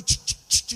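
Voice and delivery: unvoiced ch ch ch of the cars climbing; imitated rollercoaster clatter; clacking coaster chain sound. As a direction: click out the climbing ride.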